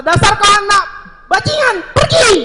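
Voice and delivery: high, loud furious shout; angry mother shouting. Acted. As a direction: throw the child out with a scream. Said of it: "seorang ibu sedang marah". (ibu marah membentak anaknya dengan teriakan keras)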